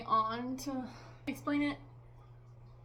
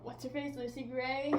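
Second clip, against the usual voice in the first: ordinary delivery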